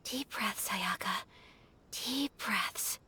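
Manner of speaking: nervous whisper before a big question; whispered self-calming. Real, not acted. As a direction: coach yourself under your breath. Calming herself before asking.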